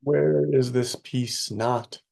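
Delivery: ordinary delivery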